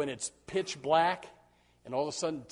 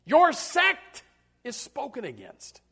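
mocking Festus; 13 semitones higher, 7 dB louder than usual. Preacher voicing Festus mocking Paul in high scorn. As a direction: scold him as a foolish fanatic. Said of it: "He said".

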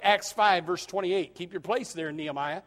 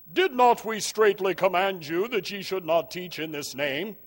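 a touch of a stern, solemn high priest. Acted. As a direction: lightly put on the high priest's accusing tone. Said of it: "That's how I think he sounds".

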